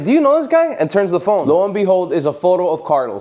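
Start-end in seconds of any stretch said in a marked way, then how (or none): 0.0-0.7 much higher, curious question; higher girlfriend voice. Retold or imitated. Girlfriend asks [name] about the photo, pitched up. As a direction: ask while showing the phone. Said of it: "My girl's like".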